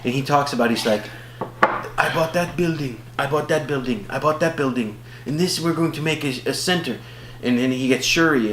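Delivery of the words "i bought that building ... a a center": measured, put-on African accent; imitated African accent. King telling sister about the building, imitated African accent. Acting the hero's announcement, accented.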